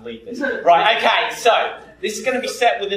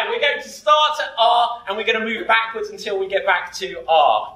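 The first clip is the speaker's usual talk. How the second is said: ordinary talk, nothing marked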